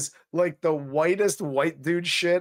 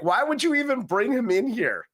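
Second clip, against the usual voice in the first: mock indignant; 9 semitones higher than usual. Retelling Chet's anger.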